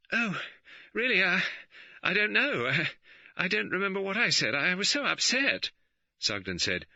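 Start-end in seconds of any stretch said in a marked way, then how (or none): 0.1-5.7 high, breathless, flustered stammer. A narrator's reading. Magdalen flustered under police questioning, high and halting. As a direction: trip over the words in a fluster. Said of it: "Magdalen was flustered".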